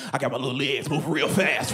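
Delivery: ordinary talk, nothing marked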